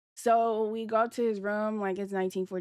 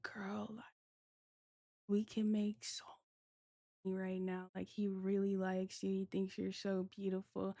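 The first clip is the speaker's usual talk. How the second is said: hushed secret pitch; 11 dB quieter than usual. Retold or imitated. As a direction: lean in and murmur it like a secret deal. Friend pulls her aside to tempt.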